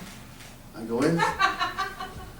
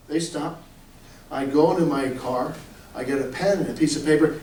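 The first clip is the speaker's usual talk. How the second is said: usual speaking voice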